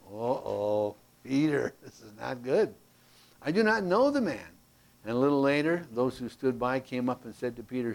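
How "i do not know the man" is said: Peter's denial. Retold or imitated. Peter's denial voiced in the story.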